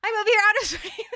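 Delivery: high, loud, laughing words; helpless laugh breaking the line; breaking into laughter. Real, not acted. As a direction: crack up mid-sentence at yourself. Cracks up at her own spite.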